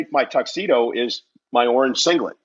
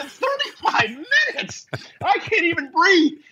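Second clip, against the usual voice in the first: panicked yelp. Retold or imitated. Panicked reply to the referee.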